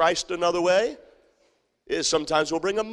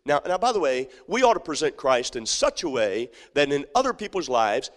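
normal talking voice

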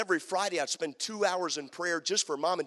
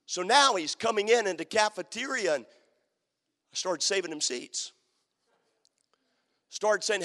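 usual speaking voice

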